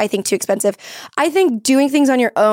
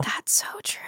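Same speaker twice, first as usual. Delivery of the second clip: barely-there whisper; hushed realization; 13 dB quieter than usual. Real, not acted. Listener struck by a point about peers, whispering in realization. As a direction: breathe it out without voice, as it sinks in.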